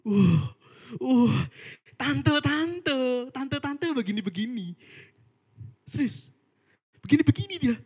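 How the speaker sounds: high, loud mock amazement at the aunties; mocking exclamation. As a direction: exaggerate the scoff, pitch up high. Mocking the aunties' lazy praise. (ejekan heboh dengan nada tinggi)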